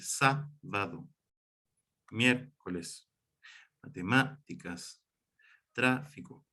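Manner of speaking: "sábado, miércoles, matemáticas, tráfico" each split after the accented syllable; slow teacher's demo of the accents in "sábado, miércoles, matemáticas, tráfico"; antepenultimate syllable stressed. Shown as a demonstration. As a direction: hold "sá", "miér", "má", "trá", pause, then finish each word. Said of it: "Ahí voy marcando la antepenúltima sílaba".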